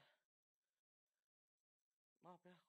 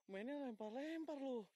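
loud complaint; 8 semitones higher, 12 dB louder, slower than usual. Real, not acted. Complaining to ghost throwing things.

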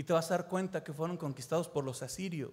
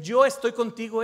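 emphatic declaration; 3 semitones higher, 9 dB louder than usual. Acted. Preacher voices God's promise.

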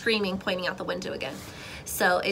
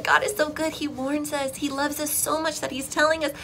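normal talking voice

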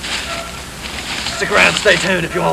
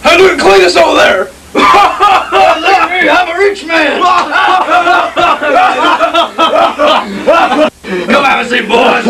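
rowdy shouting; 9 semitones higher than usual. Acted. Rowdy character bragging, laughing.

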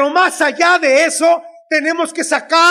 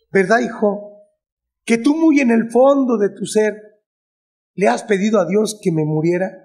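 lightly voiced mother, lower pitch; low, probing imitation of a mother; madre inquisidora; 4 semitones lower than usual. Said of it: "un día me dijo". (imitando levemente a una madre que interroga)

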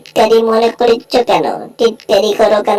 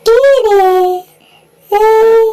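squeaky cartoon character; 13 semitones higher than usual. Beaten-up sidekick complaining to Jerry, squeaky high voice. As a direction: pitch up into a shrill little cartoon voice.

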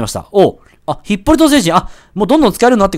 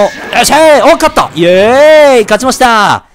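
joyful cheer; 4 semitones higher, 4 dB louder, slower than usual. Real, not acted. Winning the fight.

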